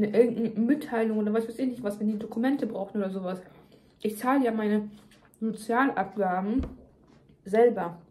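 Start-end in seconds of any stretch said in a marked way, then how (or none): none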